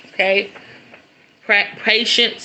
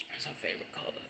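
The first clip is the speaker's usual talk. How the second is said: much softer, breathed almost voiceless; choked whisper; 7 semitones lower, 19 dB quieter, much faster than usual. Real, not acted. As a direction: say it under your breath, overcome. Overcome naming mother's favorite color.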